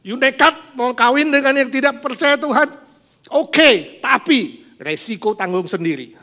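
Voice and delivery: high, loud teasing voice with a laugh; God wagging a finger at the stubborn one; mocking God's reply. Preacher voicing God teasing a stubborn believer, high and loud.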